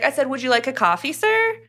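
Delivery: syrupy, fake-polite cover-up, rising high on sir; mock innocence. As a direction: play it sweetly innocent to dodge trouble. Miller covers his insult.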